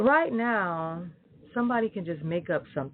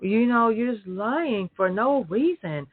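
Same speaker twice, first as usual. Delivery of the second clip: usual speaking voice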